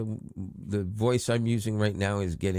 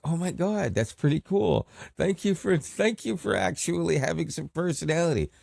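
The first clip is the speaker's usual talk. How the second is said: high, thrilled thanks; excited thanks to the AI, pitched up; delighted gush; 10 semitones higher than usual. Retold or imitated. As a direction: gush at the chatbot like it made your day. Delighted reply to chatbot.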